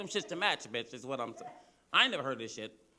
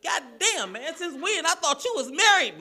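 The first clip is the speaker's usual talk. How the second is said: much higher and louder, mock disbelief; shocked shout; 11 semitones higher, 11 dB louder than usual. Comic reacts to his Marine friend, shocked mock shout. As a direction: yell it like you can't believe it.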